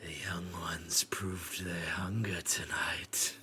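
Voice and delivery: slow, creepy whisper; sneaky whisper. Acted. Frollo sneaks into the council, creepy slow whisper. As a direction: slither the words out. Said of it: "sneaks in a little bit".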